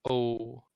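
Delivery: O like the English letter O; long oh vowel. Shown as a demonstration. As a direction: say O round and long. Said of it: "That's actually how you say it".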